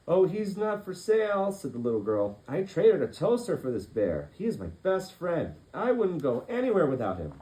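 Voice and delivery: put-on high, loud childlike voice; little girl. Little girl telling Jonathan her bear is not for sale, high. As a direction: speak up like a proud little girl.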